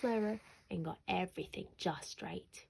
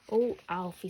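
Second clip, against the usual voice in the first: louder, exasperated 'oh'; exasperated mum; 8 dB louder than usual. A narrator's reading. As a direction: mum fed up with her son again. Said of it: "said Mum".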